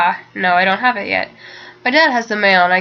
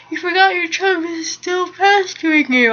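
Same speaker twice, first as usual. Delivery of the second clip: talking through a yawn, pitched up; 8 semitones higher than usual. Real, not acted. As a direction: read on while a real yawn takes over. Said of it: "I can't stop yawning".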